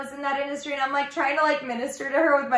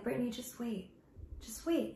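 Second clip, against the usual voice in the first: gentle God voice; 12 dB quieter than usual. Retold or imitated. Voicing God comforting her.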